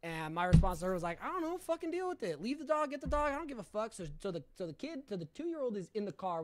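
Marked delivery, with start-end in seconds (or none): 1.2-3.9 higher-pitched, dismissive mock outburst; callous brush-off. Husband on the phone refusing to help his wife, mock callous brush-off. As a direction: brush the problem off as not yours. Retold callous response to wife's call.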